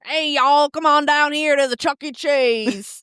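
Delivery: put-on forced country accent. Joking about fun accents, in a forced country accent. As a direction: call out in a hammed-up country twang. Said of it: "A forced country accent's not my".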